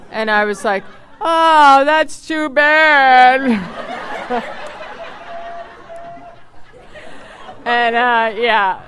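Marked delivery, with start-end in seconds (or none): 1.2-3.4 higher, louder, slow drawn-out fake pity; a hammed-up sorry-for-you coo; mock sympathy. 3.5-7.6 long, high burst of real laughter; big laugh. Real, not acted. Laughs at her own reaction.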